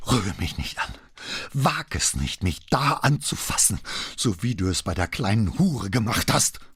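high, tense outrage; furious warning. A narrator's reading. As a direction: warn him off, voice rising in fury. Enraged wife warns husband off. (wütend drohend, mit hoher Stimme)

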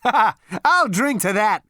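loud, high laugh into a cheer; boisterous, high-pitched delight; happy laughing. A narrator's reading. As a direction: laugh out, then crow it merrily. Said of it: "Zaphod laughed happily".

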